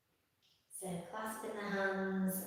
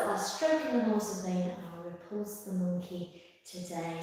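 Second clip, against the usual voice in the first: normal talking voice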